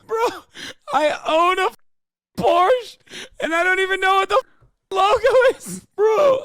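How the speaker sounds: high, loud laugh-cry through the words; laughing hard. Real, not acted. Laughing at himself over the Porsche logo, a high squealing laugh-cry. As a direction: squeal it out while cracking up.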